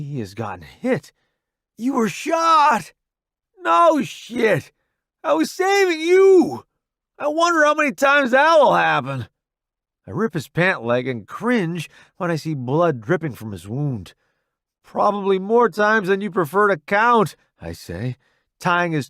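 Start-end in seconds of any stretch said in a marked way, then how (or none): 1.8-2.9 high, slow alarmed cry; shocked. Acted. Alarmed at friend's wound. 3.6-9.3 high, loud wry soldier; sarcastic soldier. Acted. Roddy wounded and sarcastic. 14.8-17.4 joking soldier. Acted. Narrator voiced in dialogue.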